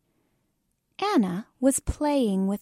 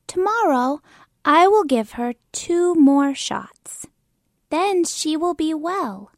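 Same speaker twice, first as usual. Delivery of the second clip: louder, self-important little doctor; pretend doctor; 6 semitones higher, 4 dB louder than usual. Kim as pretend doctor promising shots, louder and sure. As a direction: play the confident doctor.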